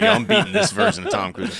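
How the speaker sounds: high, loud, laughing brag. Retold or imitated. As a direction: laugh through the boast. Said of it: "I'm like".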